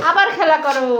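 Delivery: ordinary talk, nothing marked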